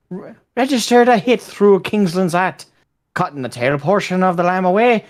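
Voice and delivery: put-on Irish accent, slightly stumbling. Reading a war anecdote to friends, Irish accent. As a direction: read it out in a rough Irish accent. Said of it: "I'll do an Irish accent".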